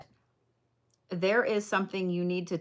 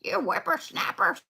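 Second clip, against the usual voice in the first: put-on high, creaky old-lady scold; grumpy grandma; 10 semitones higher than usual. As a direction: scold the kids like a cranky granny. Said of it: "I feel like my grandma".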